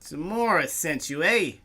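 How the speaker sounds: loud, high, mocking bouncer; suspicious orc bouncer. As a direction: sneer and press them, loud.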